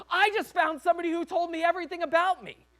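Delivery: high, loud excited scream; full-voiced, high-pitched frantic yell. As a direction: shout it out to the whole town. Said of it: "running into town screaming".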